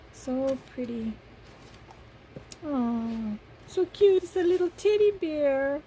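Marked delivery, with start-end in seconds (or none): 3.7-5.8 delighted squeal. Real, not acted. Delighted at teddy bear gift.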